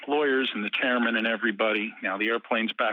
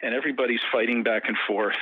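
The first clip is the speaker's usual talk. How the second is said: ordinary delivery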